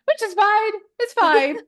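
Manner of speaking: high, loud pretend reassurance; mock-fine. Stressed mom telling a friend she copes, mock-fine.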